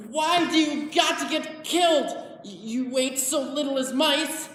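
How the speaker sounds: high, loud, anguished protest; upset outburst. Acted. Man blaming the dead pup, loud and upset. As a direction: cry out at it in frustration.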